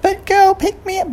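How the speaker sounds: very high squeaky plea; tiny mouse begging. Acted. Mouse pleading.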